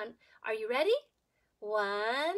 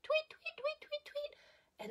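much higher, whispered tweets fading out; birdsong; 13 semitones higher, 5 dB quieter, slower than usual. Acted. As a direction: chirp like little birds, then trail off. Birds chirping.

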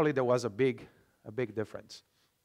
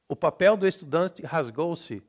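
louder Brazilian reading, all vowels sounded; Brazilian Portuguese accent; 3 semitones higher, 6 dB louder than usual. Shown as a demonstration. Teacher reading a sample, full-vowelled Brazilian Portuguese. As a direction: read it Brazilian-style, estudante ending in "chi".

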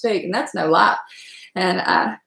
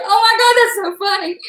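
amused exclamation; 11 semitones higher, 4 dB louder than usual. Retold or imitated. Retelling her reaction to the argument, high-pitched amusement. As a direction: squeal it out, tickled by the argument.

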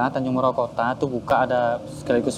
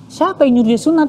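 high, loud indignant demand; outraged father; 11 semitones higher, 5 dB louder than usual. Retold or imitated. Papa demanding who told his son to get circumcised, outraged. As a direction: imitate papa's shocked outburst, voice jumping up.

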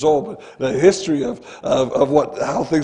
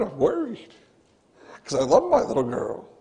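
ordinary delivery

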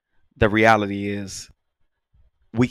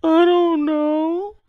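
put-on much higher, slow, drawn-out child whine; kid's voice; 6 dB louder, much slower than usual. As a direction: stretch it out like a guilty little girl. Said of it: "She's a kid, so she goes".

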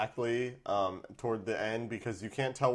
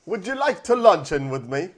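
mock British accent; 7 semitones higher, 9 dB louder than usual. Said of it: "So, she's British".

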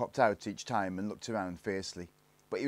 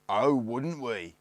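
slow, raised, drawn-out sneer; 4 semitones higher, 7 dB louder, much slower than usual. A narrator's reading. Gang leader answers Barney's dare, slow sneer. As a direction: stretch it out mockingly. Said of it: "sneered the Lone Ranger".

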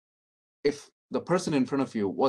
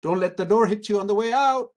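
high, loud, sarcastic send-off; hammed-up brush-off; mock snide dismissal; 11 semitones higher, 8 dB louder than usual. Shown as a demonstration. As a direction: fling it out like a mock insult. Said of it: "what you wanna say is".

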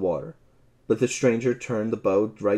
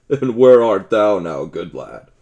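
loud mocking call, laughter in it; laughing shout; 10 dB louder than usual. A narrator's reading. Stranger jeers at Robin in the water with a laughing shout. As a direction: shout it down to the man in the stream. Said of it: "shouted the stranger, roaring with laughter".

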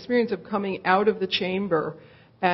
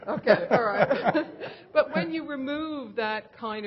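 slow, laughing it off; amused and light; 5 semitones higher, slower than usual. Real, not acted. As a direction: still chuckling as she goes on. Both laugh after the joke.

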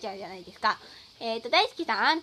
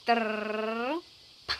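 mock drumroll; 2 semitones lower than usual. Drumroll before announcing first place.

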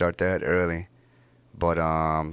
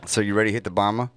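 fast; faster than usual. Real, not acted. Rushing the guest.

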